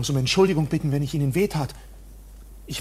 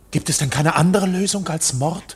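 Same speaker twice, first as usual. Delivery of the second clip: shocked; 3 semitones higher, 7 dB louder than usual. Acted. Shocked at murder talk.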